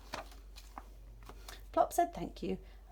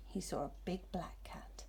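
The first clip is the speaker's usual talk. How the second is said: hushed suspense; 3 semitones lower, 9 dB quieter than usual. A narrator's reading. Narrator builds suspense.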